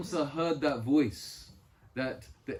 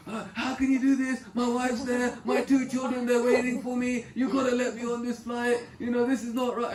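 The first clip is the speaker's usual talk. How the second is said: higher and louder, frantic pleading; panicked pleading; 9 semitones higher, 5 dB louder than usual. Retold or imitated. Stranded traveller begging airport staff to let him board, panicked. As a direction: beg the airline staff in a panic. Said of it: "Panic, panic".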